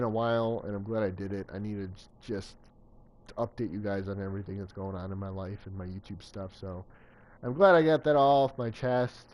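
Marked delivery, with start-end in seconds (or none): none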